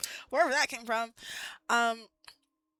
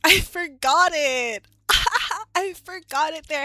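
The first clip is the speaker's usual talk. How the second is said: laughing through the words, louder and higher; 6 semitones higher, 13 dB louder, slower than usual. Real, not acted. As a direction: giggle it out at yourself. Laughing at her own mistake.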